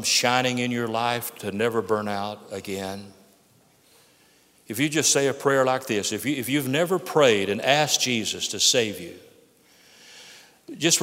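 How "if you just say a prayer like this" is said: normal talking voice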